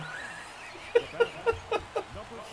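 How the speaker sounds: airy, high-pitched silent laugh; high breathless laugh. Real, not acted. Streamer laughs in disbelief at the near homer, airy high laugh.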